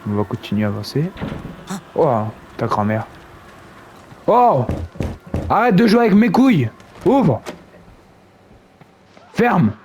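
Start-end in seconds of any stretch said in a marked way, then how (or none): 4.3-9.7 yelling. Real, not acted. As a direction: shout at the door. Yelling at the game door.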